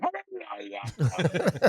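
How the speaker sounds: over-the-top mock Madden outburst; crazy Madden yell. Comedian showing his over-the-top Madden bit, hammed-up. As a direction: blurt out a wild hammed-up Madden noise. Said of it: "I'd be like".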